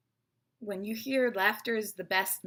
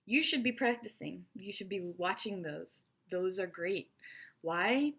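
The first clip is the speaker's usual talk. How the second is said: ordinary talk, nothing marked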